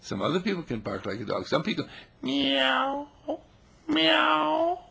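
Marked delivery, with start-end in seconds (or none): 2.2-4.8 high, slow meowing; imitating a cat. Playing a cat for the audience, high slow meow. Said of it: "they can meow like a cat".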